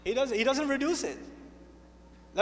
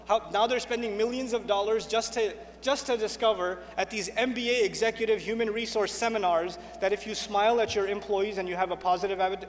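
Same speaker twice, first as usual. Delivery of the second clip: ordinary talk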